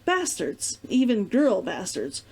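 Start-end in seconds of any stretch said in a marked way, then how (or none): none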